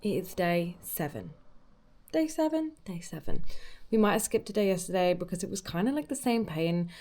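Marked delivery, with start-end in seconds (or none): 2.1-2.7 surprised. Real, not acted. Surprised at own count.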